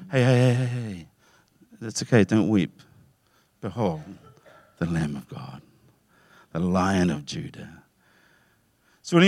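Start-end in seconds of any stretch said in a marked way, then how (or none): 0.1-7.8 softer, slowing to a hush at the end; gentle comfort. Retold or imitated. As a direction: soothe a weeping man. Angel comforts weeping John.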